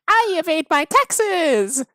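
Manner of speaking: high, slow, loud villain boast; silly villain voice. Pretend. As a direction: ham it up like a cartoon villain. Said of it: "I'm being too silly".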